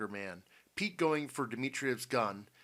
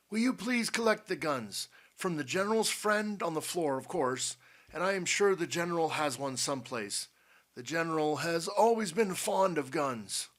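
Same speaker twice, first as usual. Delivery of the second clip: lightly voiced old man, raised pitch; courteous, commanding Potter; 6 semitones higher, 4 dB louder than usual. A narrator's reading. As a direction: give polite orders with a wry edge. Potter instructs Jupiter.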